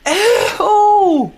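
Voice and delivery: long, high "ew"; disgusted wail. Real, not acted. Player still repulsed by the toilet scene, disgusted wail. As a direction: draw it out high in revulsion.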